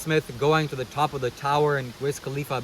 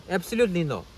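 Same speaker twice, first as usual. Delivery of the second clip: put-on higher-pitched, amateur go at an Indian guru accent; Sadhguru accent; 4 semitones higher than usual. Vlogger showing how the guru said it, rough Sadhguru accent. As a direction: attempt the guru's Indian accent. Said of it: "I can't do a good Sadhguru accent".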